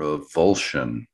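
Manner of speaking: slow, low mock-dramatic reading; slow dramatic title.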